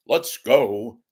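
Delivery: a hint of a stuffy radio announcer; puffed-up newsman tone, lightly done; stiff broadcaster voice. Radio host mocking a stuffy newsman, lightly voiced. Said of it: "I'm gonna talk like this".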